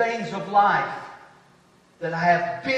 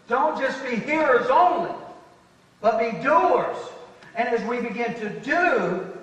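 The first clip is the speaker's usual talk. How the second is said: raised, emphatic sermon voice; firm pulpit exhortation, pitched up; preaching; 5 semitones higher, 6 dB louder than usual. Real, not acted. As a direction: exhort the congregation to act. Preaches with force.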